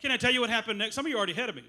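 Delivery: ordinary talk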